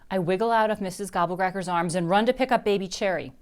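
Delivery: usual speaking voice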